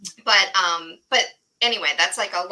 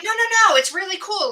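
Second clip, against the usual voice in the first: high, loud eager insistence; excited insisting; 10 semitones higher, 6 dB louder, faster than usual. Retold or imitated. Teacher insists to the art teacher, excited. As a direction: retell the teacher's thrilled protest.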